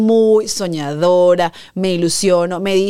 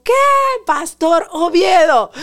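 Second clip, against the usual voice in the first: high, slow, stunned and thrilled; excited disbelief; 8 semitones higher, 3 dB louder, slower than usual. Retold or imitated. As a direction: draw the name out in amazement. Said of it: "Estaba súper emocionada".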